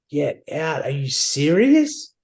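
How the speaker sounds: disbelief climbing into a high squeal; high-pitched, hammed-up thrilled reaction; retold giddy excitement. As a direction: build from stunned to squealing delight. Retelling his thrilled reaction to news.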